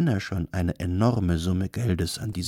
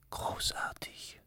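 very soft, slow whisper; breathed whisper; 14 dB quieter, slower than usual. A narrator's reading. Girl behind the curtain admires the performance, breathing it. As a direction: breathe it out, barely audible. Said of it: "hauchte das schwarze Mädchen".

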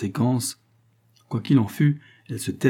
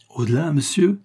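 higher, rising incredulous question; indignant exclamation; 6 semitones higher, 3 dB louder than usual. A narrator's reading. Astronomer challenges the lieutenant's answer, indignant exclamation. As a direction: throw it back in indignation.

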